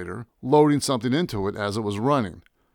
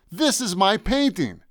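high, slow, emphatic cry; emphatic protest; 8 semitones higher than usual. Acted. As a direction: claim it as yours, voice raised. Yelling, shaking painting for emphasis.

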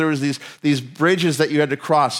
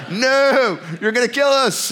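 put-on higher, half-laughing panic at the kids; a scared dad yelling stop, pitched way up; panicked shout; 8 semitones higher, slower than usual. Dad on the bridge begging his bouncing daughters, high panicked plea.

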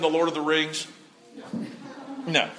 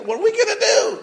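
panicked cry; 10 semitones higher, faster than usual. Retold or imitated. Panicked Israelites voiced by preacher.

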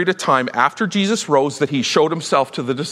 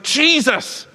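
high joyful call of the name; high excited shout of Jesus; excited cry; 12 semitones higher than usual. Retold or imitated. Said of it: "It's like".

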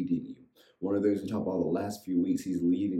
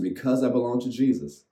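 call to repeat; 5 semitones higher, 4 dB louder than usual. Shown as a demonstration.

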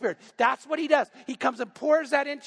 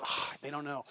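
much softer and lower, a knowing aside; wry aside; 7 semitones lower, 10 dB quieter than usual. Retold or imitated. Wife Pam teasing about the pastor's real marriage, soft and dry. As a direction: drop it under your breath, dry. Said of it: "And Pam just goes".

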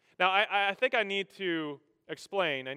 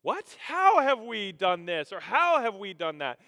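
high, drawn-out indignant disbelief; shocked question; 5 semitones higher, 6 dB louder than usual. Acted. People answering God's accusation, acted shocked and offended. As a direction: stretch out the astonished "how". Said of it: "with a shocked question".

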